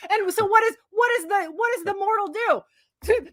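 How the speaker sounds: high-pitched rhetorical question; incredulous retelling. Retold or imitated. Reviewer setting up the god's downfall, mock-incredulous. Sets up the god's obvious folly.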